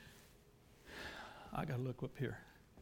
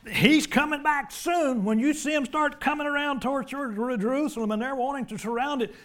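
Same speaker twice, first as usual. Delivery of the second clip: raised, louder, excited proclamation; urgent preaching; 8 semitones higher, 4 dB louder than usual. Real, not acted. Preacher warning the congregation that he's coming back soon, urgent and raised. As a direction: proclaim it high and forceful.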